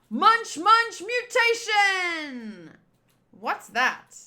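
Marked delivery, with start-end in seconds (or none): 0.1-2.8 attack shout. Acted. Villain calls his attack.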